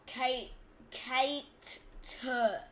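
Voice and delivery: cater split, with a hard separate t; overpushed t. Shown as a demonstration. Gamer showing what not to do, hard-t cater. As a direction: break cater in two and push the t. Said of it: "You don't put, like".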